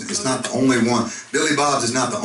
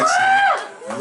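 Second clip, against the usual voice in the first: mock gushing; 4 dB louder than usual. Mock-gushing over the song.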